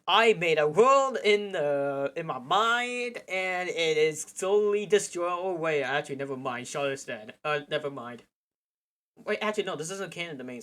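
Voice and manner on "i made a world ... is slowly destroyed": slow, high and loud Charles voice. Acted. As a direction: drawl it out as Charles. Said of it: "the Charles voice".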